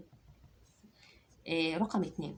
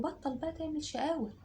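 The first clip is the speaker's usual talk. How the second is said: mock scold, pitched much higher; a hint of a cross mom hushing her kid; scolding mother; 8 semitones higher than usual. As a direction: mom scolding her naughty boy, lightly voiced. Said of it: "ضربته وقالت له".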